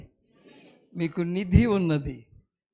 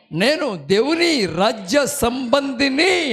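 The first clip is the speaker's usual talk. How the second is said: slow, higher, weighty declaration; bold declaration; 5 semitones higher, 4 dB louder, much slower than usual. Shown as a demonstration. Preacher showing a woman how to say it, slow and bold. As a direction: declare it slowly for all to echo. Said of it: "నువ్వు గట్టిగా చెప్పు".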